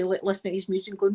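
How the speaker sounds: ordinary talk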